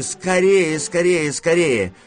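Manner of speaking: high, loud repeated urging; urgent. Acted. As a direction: hurry him along loudly. Grandmother urging him to hurry. (торопя, высоко и громко)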